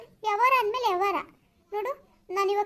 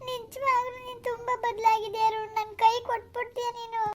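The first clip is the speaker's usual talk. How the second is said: tearful. Acted. Girlfriend accusing Arun of having changed, crying. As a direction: wail it out as a wounded girlfriend. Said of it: "ಯಾಕ್ ಅಳ್ತಾ ಇದೀಯಾ?".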